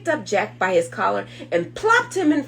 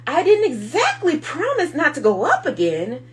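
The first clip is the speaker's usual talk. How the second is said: put-on higher, a touch louder boyish excuse; lightly voiced boy pleading his case; Jack explaining; 6 semitones higher, 5 dB louder than usual. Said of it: "Jack explained".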